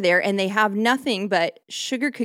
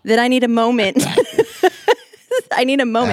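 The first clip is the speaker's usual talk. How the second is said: higher and louder, half-laughing I need a moment; mock dramatic plea; 4 semitones higher, 5 dB louder than usual. Host begging for time to rant, hammed up and laughing. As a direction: play up needing a moment, laughing.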